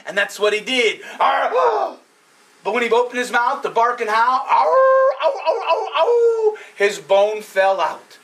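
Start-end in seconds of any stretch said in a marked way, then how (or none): 1.2-2.0 short high growl; mock dog growl. 4.5-6.6 high loud howling ow; hammed-up dog howl. Dog howls and drops bone.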